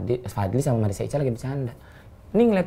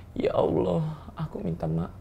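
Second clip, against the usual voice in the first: soft, higher mock-remorseful plea; badly faked near-crying apology; fake tearful apology; 4 semitones higher, 9 dB quieter, slower than usual. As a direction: plead softly, pretending to well up. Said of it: "biar kelihatan nangis".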